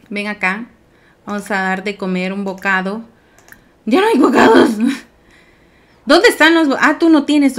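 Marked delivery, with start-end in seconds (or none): 3.9-7.6 high, loud, flustered cry; alarmed outcry. Real, not acted. Player finding the snacks gone, loud alarmed outcry. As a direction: cry out in sudden panic. Panics over missing snacks.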